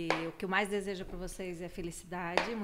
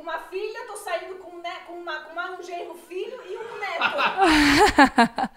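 loud laughing, slowed; 10 semitones higher, 13 dB louder, slower than usual. Real, not acted. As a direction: laugh through the words. Laughing announcement.

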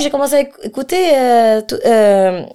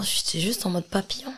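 whisper; 14 dB quieter than usual. Real, not acted. Excited talk about musical styles.

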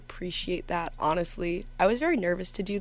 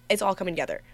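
ordinary talk

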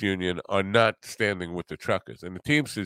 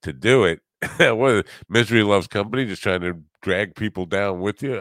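ordinary delivery

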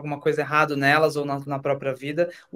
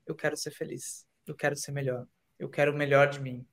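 much softer, tender wish for yourself; gentle self-talk; 3 semitones lower, 7 dB quieter than usual. Retold or imitated. Guest showing listeners kind self-talk, much softer. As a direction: speak to yourself kindly and softly.

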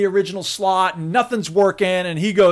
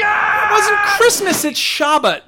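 much higher, louder exasperated shout; heated correction; 4 dB louder than usual. Real, not acted. Correcting his friend's joke, exasperated shout. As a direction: shout the correction over them.

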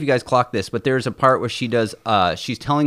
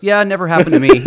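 imitating Tom; 7 semitones higher, 7 dB louder than usual. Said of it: "he goes".

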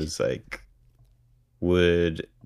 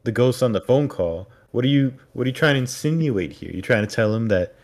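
loud, raised-pitch incredulous challenge; exasperated; 4 semitones higher, 7 dB louder than usual. Acted. Viewer challenging Erica the skeptic, loudly exasperated. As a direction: take her to task in loud disbelief. Viewer's mock scolding of a character.

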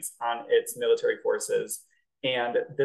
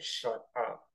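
hushed shut up; 2 semitones lower, 10 dB quieter than usual. Retold or imitated. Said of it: "You're horrified".

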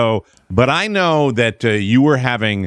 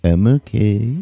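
put-on drunk voice. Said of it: "Drunky Drunkersons will be like".

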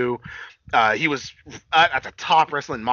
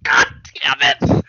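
loud, drawn-out reaction; slow, punched fan exclamation; retold exclamation; 7 dB louder, slower than usual. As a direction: stretch each word out loudly. Retelling own reaction, drawn out.

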